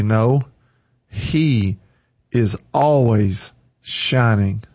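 drawn out slowly, each word apart; slow and sure; slow, deliberate reply. Pretend. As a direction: linger on every word to make it sink in.

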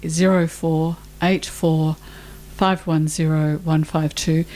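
slow, spaced-out phone number; unhurried dictation of a number; slowly. Shown as a demonstration. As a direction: read the digits slowly, pausing between groups. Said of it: "I'll say the number again slowly".

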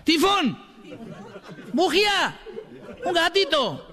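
very high yelled heckles; shouted cheers. Retold or imitated. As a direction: cry each word up at the stage. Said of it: "el negro de abajo seguía".

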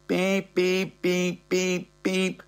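high, even electronic beeping; steady high-pitched beeps; imitated alarm beeps.

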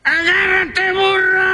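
high shouted command; ant's shout. Acted. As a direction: yell it up high to the donkey. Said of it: "y dijo".

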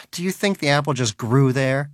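ordinary delivery, nothing marked

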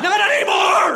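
very high, shouted battle cry; loud, high comic hero yell; heroic bald eagle. Acted. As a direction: scream it as an avenging eagle. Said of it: "He's like".

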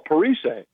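Parise said "pa-ree-SAY"; French-style pronunciation. Retold or imitated. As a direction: end Parise on a long "say". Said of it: "he would call him Parise".

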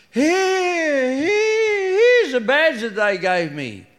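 put-on high, gloating drunk; a drunk proudly showing off his badge; drunk husband.

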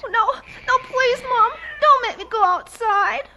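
high, urgent begging; frightened pleading. Acted. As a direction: beg Mom desperately, close to tears. Frightened child begs not to go outside.

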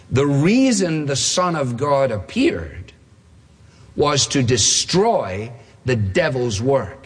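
slow, with a long pause mid-sentence; deliberate quote. Real, not acted. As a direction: read the verse out slowly and weightily. Announced quote, read out for weight.

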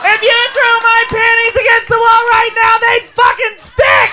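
high, shouted boast; acted wild party girl; raucous club girl. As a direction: holler to friends over club noise.

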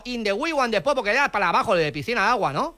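put-on foreign accent with L for R; foreign-accented broken Spanish; mock foreign accent. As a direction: play a foreigner mangling Spanish, R turned to L. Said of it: "no te entiendo".

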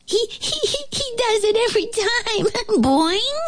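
very high, giggling flea squeak; laughing flea. A narrator's reading. Flea joins the fly's joke, laughing. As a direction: giggle through the words in a tiny high voice. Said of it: "The flea started to laugh".

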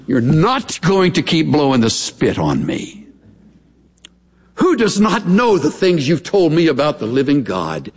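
normal talking voice

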